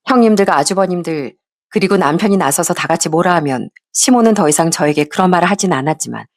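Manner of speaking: ordinary delivery, nothing marked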